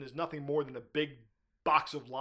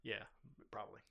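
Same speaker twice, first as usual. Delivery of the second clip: whispered aside; 6 semitones lower, 17 dB quieter than usual. Real, not acted. Muttered aside about listening phone.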